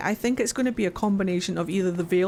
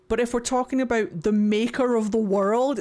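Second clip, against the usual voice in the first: ordinary talk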